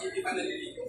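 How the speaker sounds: breathy whisper, no voice at all; whispered aside. Real, not acted. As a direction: drop to a secret whisper for the joke. Teasing aside about unwashed parents.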